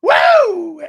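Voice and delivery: loud, high-pitched woo; excited whoop. Real, not acted. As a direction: let out a big hyped whoop. Host whoops before trade pitch.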